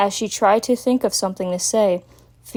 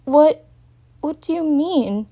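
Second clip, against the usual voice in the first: confused; 7 semitones higher than usual. Acted. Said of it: "in slight confusion".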